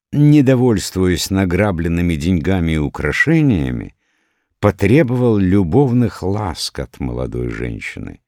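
usual speaking voice

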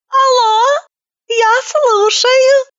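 high, teary, slow phone voice; a weepy woman picking up the phone; tearful high woman. A narrator's reading.

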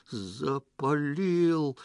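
drawn-out word of dismay; aghast exhalation. A narrator's reading. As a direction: breathe it out in horror. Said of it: "выдохнул Петро".

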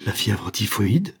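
slow, stunned question rising at the end; horrified echo of the doctor's word; shocked cry. A narrator's reading. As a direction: repeat the diagnosis in disbelief. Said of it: "s'écria-t-elle".

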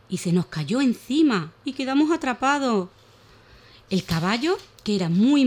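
put-on lively, animated reading of a children's tale; expressive children's story voice; storytelling for children. A narrator's reading. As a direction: tell it like a bedtime story for little ones.

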